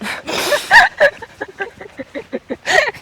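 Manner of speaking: long, high-pitched laughing fit. Real, not acted. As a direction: crack up and keep laughing. Sisters crack up after the attempt.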